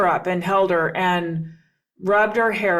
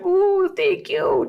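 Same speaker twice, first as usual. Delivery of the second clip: put-on high, slow, squealed thank-you of a small child; grateful squeal; 12 semitones higher, slower than usual. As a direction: squeal it like a delighted toddler. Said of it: "little squeal that sounded like a squeal of gratitude".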